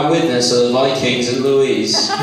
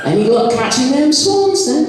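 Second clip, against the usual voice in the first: very high put-on Somerset questioning; high, mocking West Country question; mock Somerset accent; 10 semitones higher than usual. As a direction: tease with a hammed-up Somerset accent. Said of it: "always in that accent".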